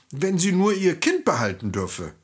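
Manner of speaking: ordinary delivery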